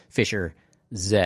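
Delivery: Fisher, a pause, then Z as "zed"; British Z, "zed". Shown as a demonstration. DJ correcting the band's name, spaced-out British "zed". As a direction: separate the words and say Z as "zed". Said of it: "because they're British".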